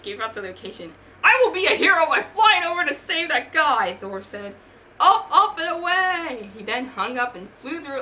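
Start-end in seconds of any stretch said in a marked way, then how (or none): 1.2-4.0 loud, high, grandiose announcement; heroic declaration. Acted. Thor announcing a rescue on the phone, heroic shout. 5.0-6.5 loud, high take-off cry; heroic call. Acted. Thor flying off to the rescue, heroic shout.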